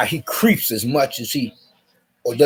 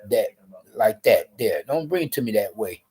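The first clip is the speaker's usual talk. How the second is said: usual speaking voice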